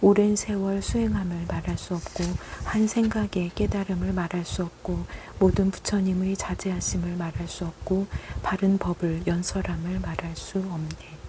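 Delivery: normal talking voice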